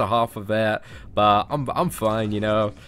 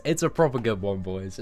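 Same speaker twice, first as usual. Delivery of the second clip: usual speaking voice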